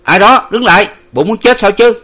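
high, loud sentry's shout; angry shouted order; loud bellowed challenge. A narrator's reading. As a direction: halt strangers with a threatening yell. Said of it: "có tiếng quát lớn".

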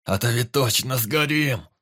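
loud, slow, strained shout; urgent cry. A narrator's reading. Wounded forester cries to the teacher, urgent. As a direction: force it out through pain.

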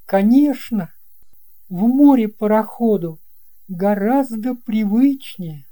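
put-on an amazed little boy asking the captain; boy's voice. Boy agrees. (высокий удивлённый мальчишеский голос)

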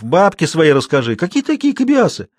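jeer, leaping up high on the question; mocking. A narrator's reading. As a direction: derisive disbelief. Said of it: "насмешливо сказал Жуков".

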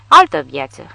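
put-on drunk exclamation. A narrator's reading. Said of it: "exclamă el, sughițând".

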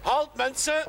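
high call to stop. Acted. Policeman calling the workers to halt. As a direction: halt the crew from afar.

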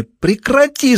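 a high, piercing shriek. A narrator's reading. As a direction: scream at him to stop. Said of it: "завизжала женщина".

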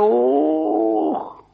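usual speaking voice

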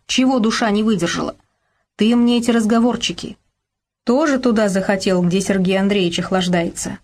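ordinary delivery

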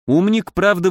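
usual speaking voice

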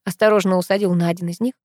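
ordinary delivery

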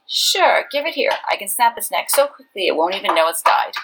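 cheerfully offers to do the dirty job; eager Wen Kuxing. Acted.

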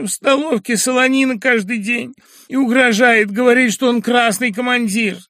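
a hint of a crying girl pouring out her woes; lightly voiced weepy girl. Young woman complaining to the professor, tearful and high.

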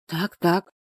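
plain reading voice

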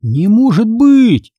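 high, drawn-out exclamation; outraged cry. A narrator's reading. Reader cheated by the book's ending, crying out. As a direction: cry out in disbelief, slowly and high. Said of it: "закричал я".

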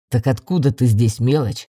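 low male character voice; deep-voiced young man wanting answers; redhead boy. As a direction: question the little one in a deep voice. Redhead boy questions heroine.

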